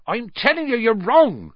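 big friend hotly defending a teacher. A narrator's reading. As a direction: argue it back hotly. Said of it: "said Hagrid hotly".

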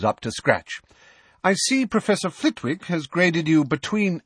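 a hint of a brisk, stern professor. A narrator's reading. McGonagall voiced in story.

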